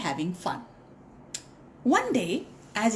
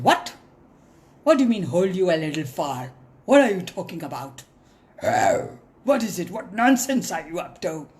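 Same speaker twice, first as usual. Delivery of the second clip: low, louder, fast and annoyed; irritated lion; 3 semitones lower, 4 dB louder, faster than usual. Acted. Lion irritated at mouse's request, fast and louder. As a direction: bark the questions back at him. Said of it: "So the lion said".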